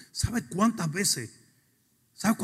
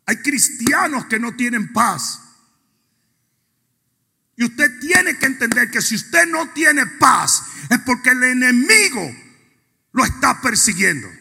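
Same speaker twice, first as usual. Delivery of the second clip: loud, sung-out preaching with music; ferviente predicación; 6 semitones higher, 6 dB louder than usual. Acted. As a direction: preach it full force, lifting each phrase. Preaching at full force. (predicación ferviente y cantada)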